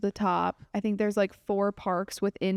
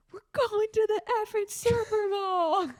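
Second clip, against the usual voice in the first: retold scream; 11 semitones higher than usual. Wife mimics her husband screaming on the sideline, high mock yell. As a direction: imitate an excited player's yell, held back. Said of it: "you screaming".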